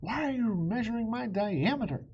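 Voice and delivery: high, loud dragon outcry; protesting loudly at the measuring; cried out. Acted. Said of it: "Circumference cried out".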